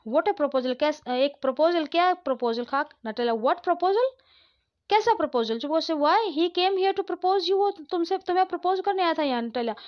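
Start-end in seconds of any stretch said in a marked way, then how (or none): 3.1-9.8 agitated exclamation. Acted. Father breaks proposal news.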